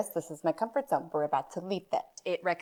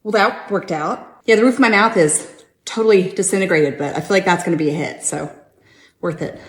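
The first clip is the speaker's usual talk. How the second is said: loud wry aftermath; 13 dB louder than usual. Acted. Burnt mouth, comedic aftermath.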